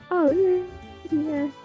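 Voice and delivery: ordinary talk, nothing marked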